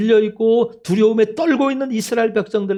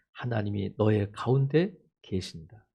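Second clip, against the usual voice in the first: very low, hushed declaration; solemn assurance; 11 semitones lower, 8 dB quieter than usual. Real, not acted. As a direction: lower the voice and assure them softly. Quiet assurance after despair. (낮고 조용하게 확신을 주며)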